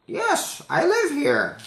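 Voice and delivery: put-on old lady mishearing the question; high-pitched confused old woman. Old lady voiced in story.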